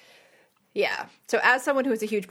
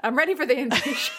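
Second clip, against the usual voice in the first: bad alien voice; 5 semitones higher than usual. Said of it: "that was terrible".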